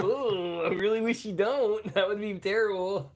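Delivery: high, whiny mock protest; high mock whine. Mock pleading with nurse.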